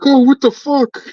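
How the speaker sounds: imitating a shocked friend yelling; shocked outburst. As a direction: squeal out the friend's horror. Friend's shocked reaction retold.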